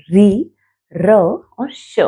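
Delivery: ordinary delivery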